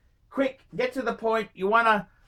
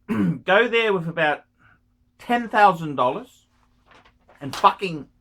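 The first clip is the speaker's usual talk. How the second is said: usual speaking voice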